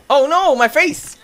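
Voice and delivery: high, loud, slowed oh no; alarmed yelp. Real, not acted. Player watching his rider faceplant, high alarmed yelp. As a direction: cry out in mock pain. Character hits face.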